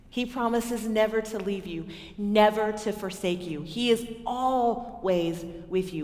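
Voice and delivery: ordinary delivery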